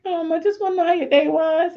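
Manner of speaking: high-pitched, strained casualness; retold squeaky mom question; awkward small talk. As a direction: act out nervously stalling in a high voice. Stalling before the hug, retold.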